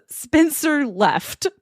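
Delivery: slow all caps. Shown as a demonstration. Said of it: "in all caps".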